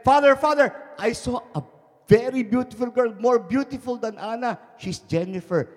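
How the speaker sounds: put-on high, loud eager boy's voice; jubilant boy. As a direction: burst in full of news. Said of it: "he was jubilant again".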